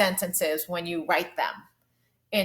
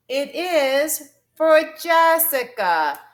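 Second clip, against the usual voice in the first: loud, high, slow "It is", then "for Jessica" as one falling group; scooped phrasing; 6 semitones higher, 7 dB louder, much slower than usual. Shown as a demonstration. Teacher showing how she would say it, loud two-part phrasing. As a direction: proclaim "It is / for Jessica" slowly and loudly. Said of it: "So I can say".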